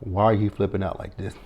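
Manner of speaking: hushed incredulous ma'am; mock-calm ma'am. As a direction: talk her down like she has lost it, quietly. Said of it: "I was like".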